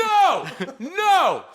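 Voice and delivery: shouting. Real, not acted. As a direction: yell it out in protest. Protesting the liquids idea.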